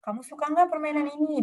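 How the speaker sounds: suara lebih tinggi, bertanya ceria; nada tanya ke anak kecil. Shown as a demonstration. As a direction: ajak anak ngobrol dengan riang. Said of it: "Itu boleh juga". (psikolog menanyai anak dengan suara ramah)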